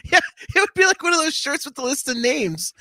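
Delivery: high giggly voice; laughing while talking. Real, not acted. Laughs at shirt idea.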